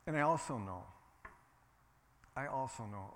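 usual speaking voice

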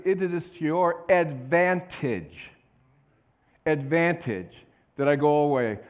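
Nevertheless rung out, then advantage slowed and leaned on; emphatic reading. Shown as a demonstration. Preacher reading verse seven to the church with emphasis. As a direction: read it with heavy emphasis, slowing on advantage. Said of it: "put some emphasis here".

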